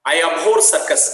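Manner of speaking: teacher reading a line of disgust to the class; reading aloud. Acted.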